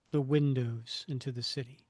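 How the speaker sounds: ordinary talk, nothing marked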